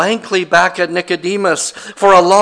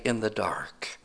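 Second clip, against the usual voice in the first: whisper; 7 semitones lower, 14 dB quieter than usual. Real, not acted. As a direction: drop to a whisper for the dark. Sermon aside, dark image.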